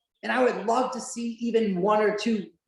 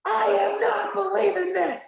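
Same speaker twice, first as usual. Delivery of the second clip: imitated announcer cry, much higher pitch; Jim Ross yell; 11 semitones higher than usual. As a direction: yell it like a disbelieving ringside commentator. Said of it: "having Jim Ross yell instead".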